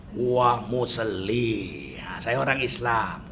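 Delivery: very slow, low and soft; slow declaration. Retold or imitated. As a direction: draw each word out low. Imitating slow foreign-language reply.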